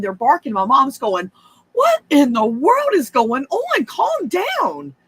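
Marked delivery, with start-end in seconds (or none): 1.7-4.9 high, startled mother asking what's wrong; alarmed mom voice. Retold or imitated. Mom hears the dogs freak out, alarmed and high. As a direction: voice a rattled mom trying to hush the dogs.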